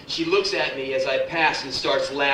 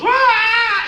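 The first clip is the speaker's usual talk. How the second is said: high, loud kung fu yell; mock action-hero war cry, pitched way up; martial-arts battle cry; 7 dB louder than usual. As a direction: let out a sustained shrieking fight cry. Bruce Lee's battle cry acted out.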